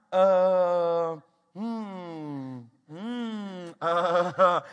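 long drawn-out "uh" and hums; stumped stalling. Acted. Dad stumped by peacock question, stalling. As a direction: hum and haw with no answer.

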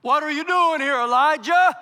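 loud, high calling out of God's question; booming divine challenge; loud rebuke. Acted. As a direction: thunder the question at him. Preacher voicing God's rebuke.

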